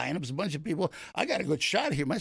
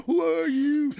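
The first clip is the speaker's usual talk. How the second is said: put-on scared little boy; 10 semitones higher, 6 dB louder, slower than usual. Son's line repeated in story.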